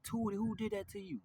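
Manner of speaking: a hint of a worried older girl asking; girl's voice. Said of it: "She was like".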